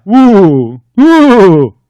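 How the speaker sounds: loud ghostly woo, rising high; ghost wail. Acted. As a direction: moan like a spooky ghost. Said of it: "he cried".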